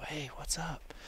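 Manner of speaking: hushed whisper into the phone. Retold or imitated. Hunter in a tree answering a friend's call, whispered. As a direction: whisper so the deer can't hear.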